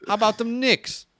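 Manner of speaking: high, loud hammed-up New York fan; cartoonish New Yorker cheer; New York accent. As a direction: crow it like a proud Knicks fan. Mocking New York sports fan.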